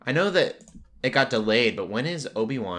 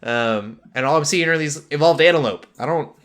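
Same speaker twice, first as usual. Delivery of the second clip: louder, higher frustrated gush; hyped-up rant; 6 semitones higher, 7 dB louder than usual. Real, not acted. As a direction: complain louder as if let down. Complaining about the movie.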